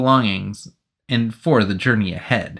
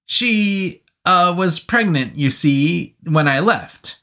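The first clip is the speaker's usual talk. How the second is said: higher, halting nervous soldier; halting explanation; 5 semitones higher, slower than usual. Acted. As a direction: fumble through the excuse. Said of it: "the man tried to explain".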